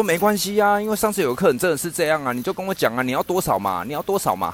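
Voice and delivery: fast, insistent vendor; 一直追问的老板; pushy vendor. Retold or imitated. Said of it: "有一种咄咄逼人的感觉". (咄咄逼人地追问要多少)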